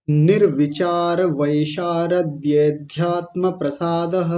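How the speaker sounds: slow, with ध्यात्म joined straight onto वैशारद्ये and no pause or अ; in one flow. Shown as a demonstration. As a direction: chant it without stopping after वैशारद्ये. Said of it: "पूरा flow में एक साथ बोलिए".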